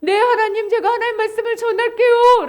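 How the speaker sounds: high, eager startled reply; startled obedience. Acted. Startled Ezekiel promising God to deliver the word, high and eager. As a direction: answer up high and promptly. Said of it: "에스겔이 깜짝 놀라가지고".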